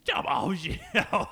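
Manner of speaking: laughing slow Cockney drawl; Cockney accent. Pretend. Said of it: "the guy who has the Cockney accent".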